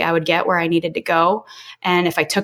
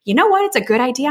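sudden bright idea; 7 semitones higher, faster than usual. Retold or imitated. Telling how she decided to start network marketing, excited high burst. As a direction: retell the lightbulb moment with a high excited lift. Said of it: "And so I was like".

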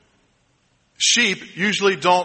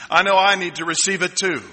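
ordinary talk, nothing marked

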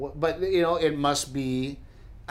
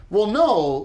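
a louder, higher mock retort; mock deadpan; 7 semitones higher, 6 dB louder than usual. Comedian answering angry fans, mildly sarcastic. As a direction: toss it off sarcastically. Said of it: "And I'm like".

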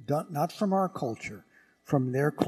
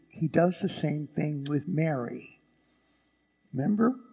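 ordinary talk

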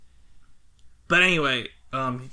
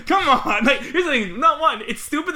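laughing exasperation; 12 semitones higher, 5 dB louder than usual. Real, not acted. Exasperated at the movie's ending.

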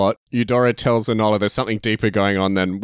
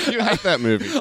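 laughing tease; 5 semitones higher than usual. Real, not acted. Teasing while laughing.

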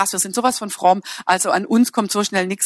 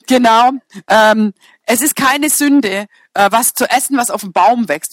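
ordinary talk

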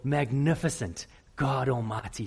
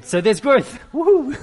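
loud, high triumphant whoop; loud joyful woohoo; cheering; 10 semitones higher, 10 dB louder than usual. Real, not acted. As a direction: celebrate with a big cheer. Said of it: "celebrating his own growth".

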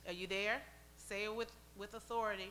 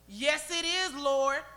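loud, raised acted comeback; insistent plea; 9 dB louder, much slower than usual. Canaanite woman answers Jesus back, loudly insistent. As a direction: answer back forcefully, still begging.

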